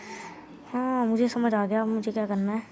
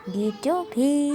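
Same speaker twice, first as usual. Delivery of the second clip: odd cartoon voice; 3 semitones higher, much slower than usual. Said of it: "तेरी आवाज़ को क्या हुआ".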